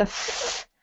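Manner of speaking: "f" as a long voiceless hiss of air; isolated F sound. Shown as a demonstration. Teacher introducing the sound, "f" breathed out by itself. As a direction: hold "f" alone, without voice. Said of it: "sound is what we're looking at first".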